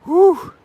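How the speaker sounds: very high, loud whoop-like whew; loud relieved whew. Real, not acted. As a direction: let out a piercing whew of relief. Relief after losing the stream.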